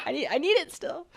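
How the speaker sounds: usual speaking voice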